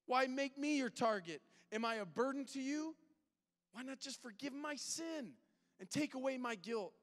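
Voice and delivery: crying out to God. Acted. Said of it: "he's crying out to God".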